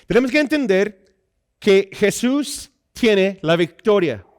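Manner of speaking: high-energy pulpit delivery; emphatic preaching. Acted. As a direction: proclaim it to the congregation, stretching key words.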